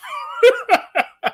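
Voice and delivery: a burst of high laughter; real laugh. Real, not acted. Reacting to absurd conspiracy.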